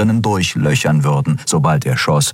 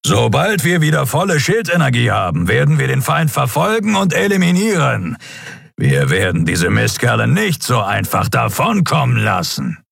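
high, forceful address to the crew; determined captain's battle speech; resolute captain; 7 semitones higher than usual. Acted. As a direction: vow pursuit with rising intensity.

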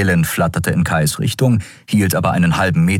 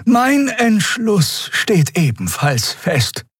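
slow, high-pitched elder; dignified old Xea; much slower than usual. Acted. Elder declaring her decision to join, dignified. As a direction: announce it slowly with firm dignity.

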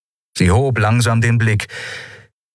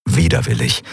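ordinary delivery

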